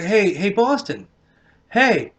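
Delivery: high teasing call across the room; mock-yelling his name to get attention. Girlfriend calling out to him across the restaurant, high and teasing.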